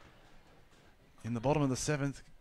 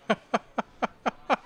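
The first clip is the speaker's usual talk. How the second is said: laugh; 9 semitones higher, 5 dB louder than usual. Real, not acted. Co-commentator laughs on air.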